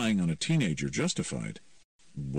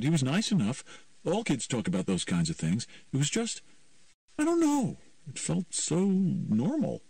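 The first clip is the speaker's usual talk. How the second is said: put-on high teen voice, searching for words; puzzled teen girl; 9 semitones higher than usual. Ema describes Patrick to Myron, puzzled teen. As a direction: play a teenager unsure how to explain it. Ema's dialogue.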